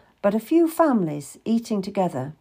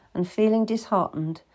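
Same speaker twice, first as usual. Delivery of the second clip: plain reading voice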